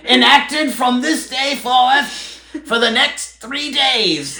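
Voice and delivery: put-on high, slow old British town crier proclamation; hammed-up old British herald reading a decree; mock town crier British accent. Hosts mock the breakup text as a town crier proclamation, high and pompous British. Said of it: "the old British accent".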